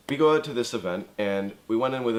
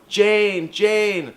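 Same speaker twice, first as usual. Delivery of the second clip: high, loud call across the room; shrill two-note call for a friend; 12 semitones higher, 10 dB louder than usual. Retold or imitated. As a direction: yell her friend's name. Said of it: "calls her friend".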